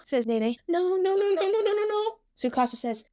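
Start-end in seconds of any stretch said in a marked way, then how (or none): 0.7-2.2 high, running string of refusals; panicked refusal. A narrator's reading. Nene fleeing on the robot, panicked refusal. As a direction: let the words tumble out high in panic. Nene flees her pursuers.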